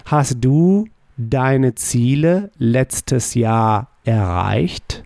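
slow, careful German question; slow model question. Shown as a demonstration. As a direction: lay the words out slowly. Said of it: "How would you say".

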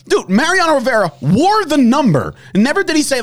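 high, heated disbelief; outraged. Real, not acted. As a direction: pitch way up in outrage. Continues outraged rant.

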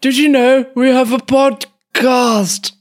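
put-on high old podcaster greeting a lady; comic elderly charmer. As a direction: chat her up in a high old voice, drawing out the end. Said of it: "My, mine was, uh, hello".

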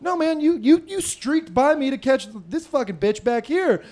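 high, stumbling comic outburst; mock indignant protest. Driver protesting to the cop, high mock-indignant outburst. Imagined angry comeback to the cop.

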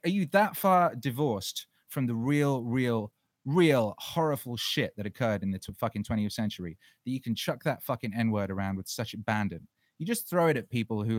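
ordinary talk

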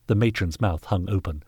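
usual speaking voice

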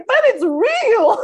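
put-on high, loud, drawn-out childlike objection; mock protest. Candidate insisting to the moderator about his badge, mock protesting.

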